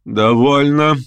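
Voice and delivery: высокое решительное пресечение; оборви разговор одним словом, повыше; отрезала. A narrator's reading. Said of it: "отрезала она". (решительно, как отрезав)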